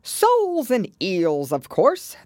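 high, drawn-out, impatient answer; rather impatient. A narrator's reading. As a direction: stretch it out as if it were obvious. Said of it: "replied rather impatiently".

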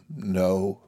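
low-key, crushed answer; small, beaten reply; hopeless. A narrator's reading. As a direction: answer dejectedly. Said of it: "hopeless and crushed".

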